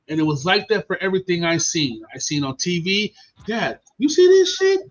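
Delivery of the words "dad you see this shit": put-on kid voice. Child self asks dad.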